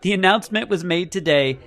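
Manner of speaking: measured press-release reading voice. Acted. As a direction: read it like an official announcement.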